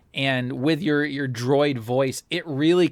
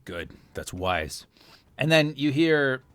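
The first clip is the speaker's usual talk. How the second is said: usual speaking voice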